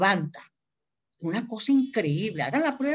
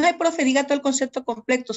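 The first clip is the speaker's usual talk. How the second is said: higher, louder, quick imitation of a student's request; Brigitte; 5 semitones higher, 5 dB louder, faster than usual. Student asking the teacher for the whole concept, imitated higher and quicker. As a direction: voice a pupil's eager plea, pitched up and fast. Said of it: "Fíjate que Brigitte me dijo".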